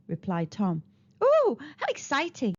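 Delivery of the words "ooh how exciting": excited. Acted. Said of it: "cried Arabella".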